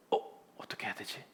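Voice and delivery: whispered, hurried aside to oneself; acted-out anxious whisper; worried whisper. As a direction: whisper it fast, as if at a loss. Acting out Moses facing crisis.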